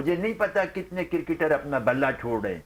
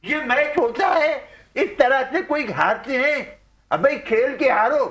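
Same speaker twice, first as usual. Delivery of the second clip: mock outrage; 9 semitones higher, 5 dB louder than usual. Pundit quits in mock outrage.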